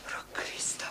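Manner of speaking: breathless whispered gasp of dread; mother whispers in horror at the news; horrified whisper. Acted. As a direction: whisper it in shock. Mother hears daughter is trapped.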